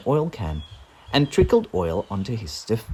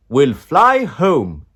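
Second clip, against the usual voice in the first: wizard voice; 5 semitones higher, 9 dB louder, much slower than usual. A narrator's reading. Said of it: "he said".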